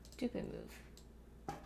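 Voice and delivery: breathy whisper, slow, to herself; whispered mutter. Real, not acted. Alone at the game, a slow whispered mutter. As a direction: drag it out under the breath. Muttering to self while gaming.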